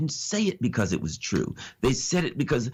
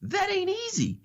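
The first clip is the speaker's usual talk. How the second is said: mock disbelief. Sarcastic aside after the example.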